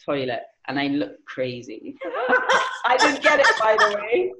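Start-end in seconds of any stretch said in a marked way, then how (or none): 2.0-2.8 laugh. Real, not acted. Laughing at the story. 2.8-4.3 high laughing aside; giggling punchline. Real, not acted.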